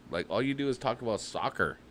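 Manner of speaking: ordinary delivery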